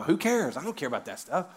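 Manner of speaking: ordinary talk, nothing marked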